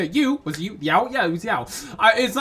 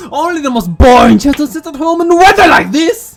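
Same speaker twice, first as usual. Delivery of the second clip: imitating the child, louder and higher; mock child voice; 7 semitones higher, 7 dB louder than usual. Player mocking a character's line, mock child voice. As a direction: repeat the line like a snotty kid. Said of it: "I thought it was me talking".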